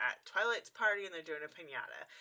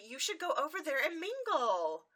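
encouraging friend; 9 semitones higher, 4 dB louder than usual. Retold or imitated. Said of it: "So Twilight tells Rarity".

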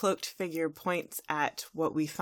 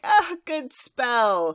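loud, high, very slow dopey cheer; doltish admirer; 6 semitones higher, 8 dB louder, much slower than usual. Acted. Thirsty boys praising Trixie's spell, slow loud fawning. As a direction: drag it out loudly in goofy admiration. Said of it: "they think it's hilarious".

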